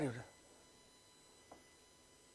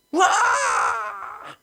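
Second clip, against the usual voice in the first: loud, much higher wail; crying out; 6 dB louder than usual. Retold or imitated. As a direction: burst out like a sister breaking down. Sister bursts into tears.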